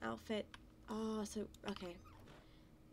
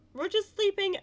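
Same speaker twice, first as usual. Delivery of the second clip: much higher and louder, bursting with delight; excited squeal; 11 semitones higher, 11 dB louder than usual. Real, not acted. Gamer squealing at a sleeping horse. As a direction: squeal it out with glee.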